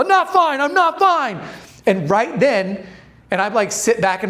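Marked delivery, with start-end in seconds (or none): 0.0-1.4 much higher, panicky cry; a hammed-up panic, pitched way up; freaking out. Partner losing it at the wheel, mock panicked. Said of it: "He starts banging on the steering wheel".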